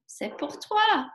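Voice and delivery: high and bright "tiens, maman"; eager little rabbit. Acted. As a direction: offer it like an eager child. Rabbit gives flowers to mummy.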